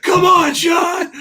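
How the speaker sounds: high, loud mock shout; hammed-up shout from wife. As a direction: cheer it like a pushy wife. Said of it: "she's like".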